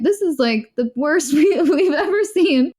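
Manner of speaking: amused, breaking into a laugh mid-quote; laughing through it. Retold or imitated. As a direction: giggle through the line as you retell it. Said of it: "Everyone was laughing".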